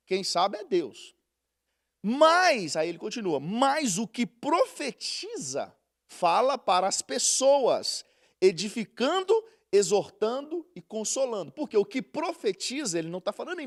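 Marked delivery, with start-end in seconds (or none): 2.0-2.8 slow, solemn Bible reading; deliberate preacher's reading voice; scripture reading. Acted. Reads scripture. 3.4-11.5 slow, solemn Bible reading; deliberate preacher's reading voice; scripture reading. Acted. Reads scripture.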